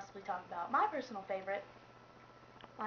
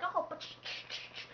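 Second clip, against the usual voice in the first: hushed shushing; 6 semitones higher, 6 dB quieter than usual. Retold or imitated. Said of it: "They're just like".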